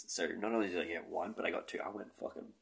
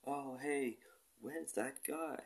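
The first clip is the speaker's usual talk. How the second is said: much higher, slow mock fan voice; a much higher, slow imagined fan asking for him; mock curious fan; 8 semitones higher, much slower than usual. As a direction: drawl it out like a puzzled fan. Imagines fans missing him.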